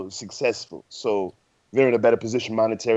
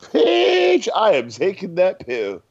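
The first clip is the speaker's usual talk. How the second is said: high-pitched opening word, then slow and punchy; emphatic insistence; 10 semitones higher, 4 dB louder, much slower than usual. Real, not acted. Podcast host answering co-host who refused the pill, emphatic insistence. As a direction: draw it out loudly, utterly sure of yourself.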